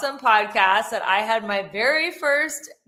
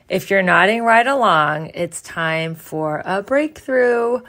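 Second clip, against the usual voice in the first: punchy, louder promo voice selling coaching; upbeat ad read; 3 semitones lower, 6 dB louder than usual. Acted. [name] pitching her coaching program to listeners, energetic ad read. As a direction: sell the program with bright energy.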